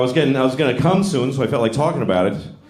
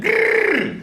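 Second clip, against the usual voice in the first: crazy person noise. Retold or imitated. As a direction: blurt a wild high brrr. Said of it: "Then I heard it again".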